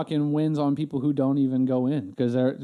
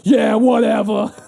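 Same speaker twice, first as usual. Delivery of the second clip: louder, slow, dismissive yeah whatever; dismissive clerk; 5 semitones higher, 9 dB louder, slower than usual. Retold or imitated. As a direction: brush him off loudly.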